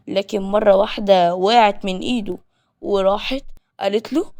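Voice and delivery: ordinary delivery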